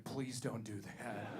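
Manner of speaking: slow, low, hushed begging; mock fearful plea. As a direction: plead quietly, imitating a scared friend. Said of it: "looked at me with more fear".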